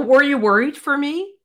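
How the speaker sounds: a hint of the coy heroine asking her protector; lightly voiced heroine. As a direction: ask it in a lightly put-on sweet girl voice. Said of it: "And then she's all".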